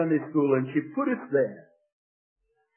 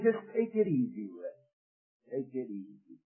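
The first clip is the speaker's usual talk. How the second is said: hushed, soothing aside, trailing lower and softer; little voice; 3 semitones lower, 9 dB quieter than usual. Acted. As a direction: let it fade to a soft murmur of reassurance. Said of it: "a little voice on my shoulder say".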